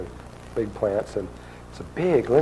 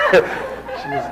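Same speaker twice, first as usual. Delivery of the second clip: laugh; 8 dB louder than usual. Real, not acted. Laughing through the retelling.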